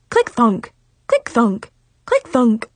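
rhythmic click-thunk sound effect, evenly repeated; imitated hobbling heel. A narrator's reading. Girl mimicking rival's broken heel, rhythmic clunking. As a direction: punch out each limping step.

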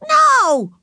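put-on high, loud child's scream; screaming refusal. A narrator's reading. Ramona keeps the lollipop from Wayne, screaming. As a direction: shriek it like a defiant little girl. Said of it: "screamed Ramona".

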